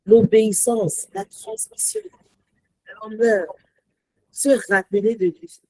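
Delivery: ordinary delivery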